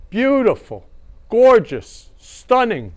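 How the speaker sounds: high, loud gushing over a photo; gushing praise. Real, not acted. Host gushing over a wedding photo, high and delighted. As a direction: swoon over each word.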